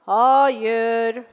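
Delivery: loud, firm refusal; stern father. Acted. Father refusing the child's request, stern and loud. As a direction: refuse flatly as a strict father.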